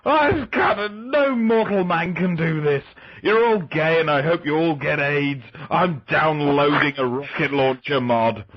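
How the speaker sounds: put-on high, slurred drunken ranting; drunk guy rant. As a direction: rant like a wasted gamer. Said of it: "one drunk guy just kinda going".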